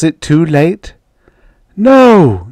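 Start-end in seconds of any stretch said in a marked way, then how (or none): 1.8-2.5 high, loud cry of defiance; desperate high shout; scream. A narrator's reading. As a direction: scream it out in refusal. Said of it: "she screamed".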